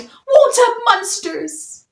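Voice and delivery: high, loud, slow scared shout; frightened cry. A narrator's reading. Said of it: "And one cried".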